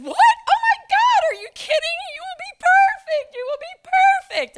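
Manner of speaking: high-pitched, pushy, overeager shout; shrill fake-sweet office bully; eager coworker. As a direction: squeal it like a pushy coworker volunteering someone. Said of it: "And she said".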